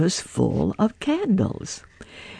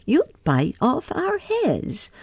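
higher little mouse voices; mice answering; 9 semitones higher than usual. A narrator's reading. Mice refusing Pussy, higher. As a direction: squeak it back at the cat. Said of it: "Three little mice sat down to spin".

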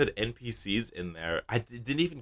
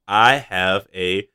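slow, loud echoing announcer voice; dramatic slowed announcement; 3 semitones higher, 8 dB louder, much slower than usual. Acted. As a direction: stretch it out like a warped effect. Announcing a quiz segment.

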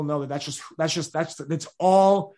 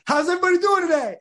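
much higher and louder, a big showman's greeting; energetic presenter; 14 semitones higher, 6 dB louder than usual. Retold or imitated. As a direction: boom out a hype-man hello to the whole room. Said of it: "I'm, like, bringing the energy".

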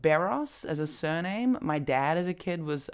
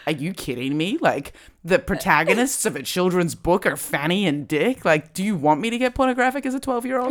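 incredulous, louder, rising higher toward the end; mock outrage; 4 semitones higher, 6 dB louder than usual. Retelling childhood disbelief at a children's book, mock outrage. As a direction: build into exasperated disbelief.

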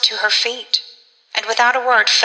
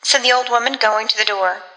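ordinary delivery, nothing marked

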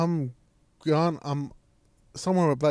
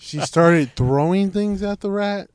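louder, slow, drawn-out disbelief; incredulous echo; 3 semitones higher, 5 dB louder, slower than usual. Retold or imitated. Son hearing mom threw things at rat, incredulous. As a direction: repeat it back stretched out, unable to believe it. Incredulous reply to dad's news.

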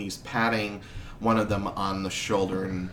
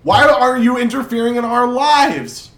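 much higher, loud protesting crowd voices; angry crowd; 13 semitones higher, 9 dB louder than usual. Acted. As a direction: demand answers as an upset crowd. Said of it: "rumble and mumble".

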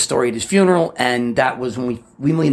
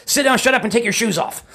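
stern barked order; 10 semitones higher than usual. Retold or imitated. Stepfather snapping at kid in his new Rolls, sharp bark. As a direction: bark it like a nervous new car owner. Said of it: "he goes".

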